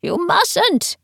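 high-pitched frightened warning; terrified old man. A narrator's reading. Teacher warns them from the top of the ladder, terrified. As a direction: plead from above in wild terror. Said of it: "replaced by wild terror".

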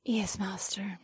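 submissive whisper. Acted. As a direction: breathe yes, master softly. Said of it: "The others responded".

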